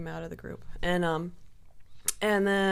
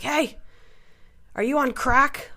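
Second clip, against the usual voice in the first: raised, higher heckling call; mock yell, louder and higher; retold yelling; 7 semitones higher, 6 dB louder than usual. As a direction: act out a drunken challenge to someone. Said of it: "So I started yelling at this guy".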